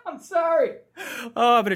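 high, laughing apology; helpless laughter. Real, not acted. Host cracks up reading a bad review of himself, laughing.

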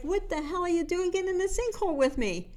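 a trapped man can't believe his friend jumped in; incredulous shout. Retold or imitated. Guy in sinkhole shouts at Dave.

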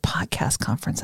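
hushed whisper of a divine word; whispered message. Retold or imitated. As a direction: breathe the words, barely voiced. Hearing God's quiet words.

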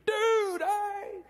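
high friendly yell; calling out. Retold or imitated. Preacher greeting the dealer from the stoop, high calling shout. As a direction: call out across the street. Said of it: "I'd get out on the stoop".

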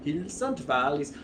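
put-on quick first try at a Welsh accent. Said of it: "can you do a Welsh accent for that character".

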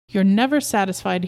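plain reading voice

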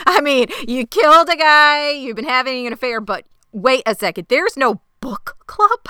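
hammed-up betrayed husband; mock outrage. Imitating husband's outraged disbelief.

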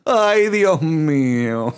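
slow, hammed-up Mexican "Ay, Dios mío"; mock Mexican exclamation, drawn out; Mexican accent. Reacting to the ChatGPT joke with a slow mock Mexican sigh. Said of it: "I'm doing this in a Mexican accent".

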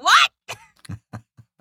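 high, loud shocked cry. Retold or imitated. Daughter hearing dad's war story, shocked high cry. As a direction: blurt it out in disbelief. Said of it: "And I was like".

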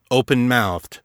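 ordinary delivery, nothing marked

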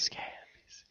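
breathy stage whisper. Pretend. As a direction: hush it like a secret. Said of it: "I like saying it like that".

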